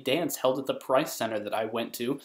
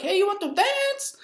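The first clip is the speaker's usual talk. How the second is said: very high falsetto; hammed-up squealed invitation; loud girl. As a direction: imitate a pushy party girl. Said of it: "this girl who was loud".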